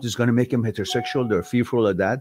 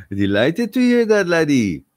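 higher, louder hammed-up Irish-Scottish brogue; 5 semitones higher, 5 dB louder than usual. Thanking a viewer, in a hammed-up Irish-Scottish brogue. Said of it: "It's Scottish, isn't it? It's a mix".

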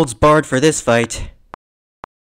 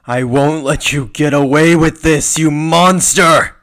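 loud outrage, building to a shout; angry defiance; 3 semitones higher, 6 dB louder than usual. Acted. Hero facing a monster, furious defiance rising to a shout.

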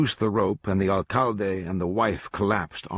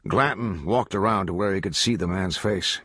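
plain reading voice

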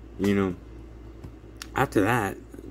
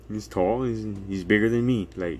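ordinary delivery

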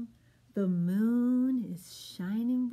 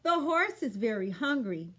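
ordinary delivery

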